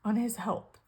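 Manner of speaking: real emotion catching the voice; getting emotional. Real, not acted. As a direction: push the phrase out through rising tears. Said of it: "I'm gonna get emotional again".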